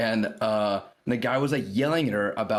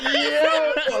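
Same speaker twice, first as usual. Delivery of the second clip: much higher, louder squeaky panicked yo; Jake's panic squeak; 6 dB louder than usual. Retold or imitated. Jake panicking at the threat, squeaky high yelp. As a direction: squeak it like a scared friend. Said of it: "used the house sound".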